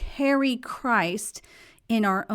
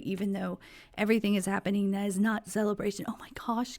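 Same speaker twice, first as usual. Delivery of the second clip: hoarse, fading voice, barely voiced; losing voice; 7 dB quieter, much faster than usual. Real, not acted. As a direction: voice giving out to a whisper. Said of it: "hear me losing my voice".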